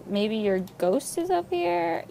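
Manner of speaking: ordinary delivery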